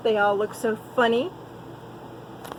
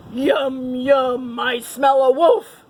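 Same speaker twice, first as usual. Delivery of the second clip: bellowing monster; 2 semitones higher, 5 dB louder, slower than usual. A narrator's reading. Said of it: "a deep voice bellowed".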